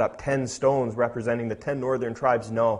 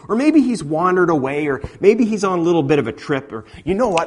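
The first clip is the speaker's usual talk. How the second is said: sarcastic taunting suggestions; mocking; 4 semitones higher, 7 dB louder than usual. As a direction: needle them playfully. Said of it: "Elijah started mocking them".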